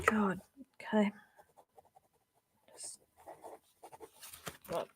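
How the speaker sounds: quiet and pained from a headache. Real, not acted. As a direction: talk through a headache. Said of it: "Just got a bit of a headache happening".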